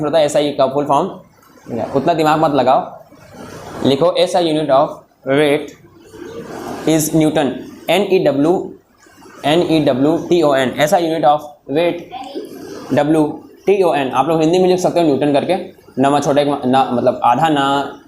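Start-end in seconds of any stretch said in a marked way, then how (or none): 4.1-7.5 slow dictation in short chunks. Real, not acted. Teacher has students write it down, slow halting dictation. 7.9-14.1 slow letter-by-letter dictation; spelling out. Real, not acted. Teacher spells newton for students, slow dictation.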